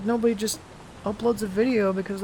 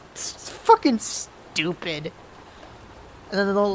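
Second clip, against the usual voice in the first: ordinary delivery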